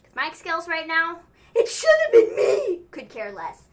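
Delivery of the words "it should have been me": very high, loud, tearful yell; mock anguished wail. Mimicking the wrestler's mic skills, mock anguished wail. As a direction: wail it out, hammed up.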